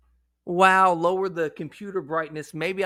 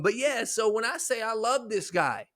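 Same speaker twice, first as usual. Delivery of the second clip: usual speaking voice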